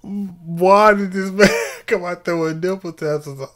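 laughing while asking; words broken by laughter. Real, not acted. Laughing through the question.

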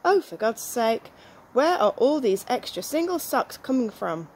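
usual speaking voice

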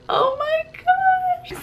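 drawn-out high squeal. Real, not acted. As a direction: squeal it out slowly, delighted. Reacting to Ginny's poem.

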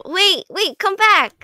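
loud, high urgent call; calling after someone. Pretend. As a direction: call them back as they run off. Calling after someone running away.

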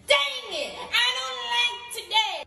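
slow, high, drawn-out whine with a laugh; whiny catchphrase. Acted. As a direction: sulk it out, half laughing. Kid's catchphrase after scolding.

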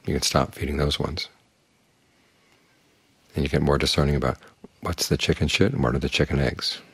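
ordinary talk, nothing marked

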